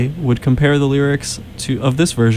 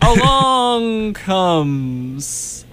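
slow drawl; 8 semitones higher, much slower than usual. Pretend. Mock traffic announcer drawl.

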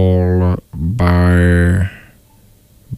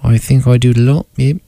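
put-on New Zealand accent; 4 semitones higher than usual. Dodging Ben's question, still New Zealand accent. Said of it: "get good at accents".

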